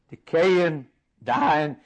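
ordinary delivery

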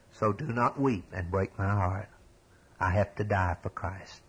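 a condemned man gently telling his family goodbye; tender farewell. Acted. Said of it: "here's what he said".

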